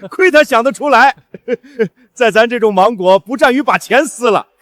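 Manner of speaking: high, derisive words through laughter; laughing scorn. Acted. Schemer jeering at rival's mango folly, laughing scorn. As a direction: jeer at him while still laughing.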